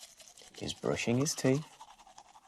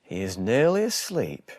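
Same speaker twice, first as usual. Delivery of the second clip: usual speaking voice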